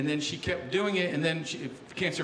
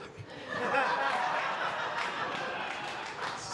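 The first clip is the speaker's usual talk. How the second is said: laughter; 7 semitones higher, 7 dB quieter than usual. Real, not acted. Laughing at own punchline.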